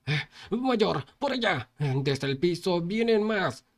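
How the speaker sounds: high, slow alarmed call; alarmed shout. Acted. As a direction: point out the new threat urgently. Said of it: "gritó".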